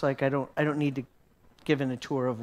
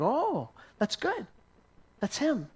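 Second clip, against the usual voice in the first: reassuring; 10 semitones higher, slower than usual. Retold or imitated. Reassuring the startled man.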